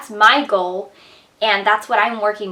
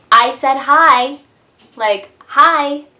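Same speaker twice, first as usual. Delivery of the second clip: put-on loud, pushy little-girl hello; mock child calling out hi, much louder; insistent child; 5 semitones higher, 9 dB louder, slower than usual. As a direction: demand a hello back like a stubborn kid.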